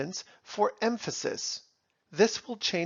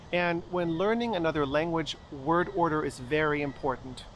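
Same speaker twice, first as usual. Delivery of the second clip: ordinary talk, nothing marked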